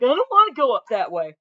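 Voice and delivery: playful whiny dog complaint; huffy dog voice. Retold or imitated. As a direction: voice the dog's stubborn huff. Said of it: "He's huffing like".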